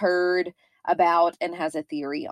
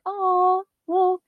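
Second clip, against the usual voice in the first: higher-pitched mock listener reaction; a lightly put-on, cooing higher voice; imitating listeners; 10 semitones higher than usual. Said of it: "and they're like".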